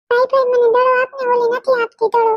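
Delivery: put-on a kid begging her brother for the ball; whiny child. Little girl whining to her brother about the ball.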